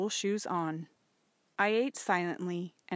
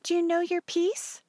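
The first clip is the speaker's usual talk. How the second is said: high, louder motherly question; lightly voiced mother; 11 semitones higher, 5 dB louder than usual. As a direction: ask as the mother, pitched up.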